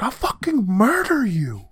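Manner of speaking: high, drawn-out mock-angry yell; mock furious threat. Co-host acting out the family fight, exaggerated angry threat. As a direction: play-act a slow, high-pitched threat. Retold family yelling match.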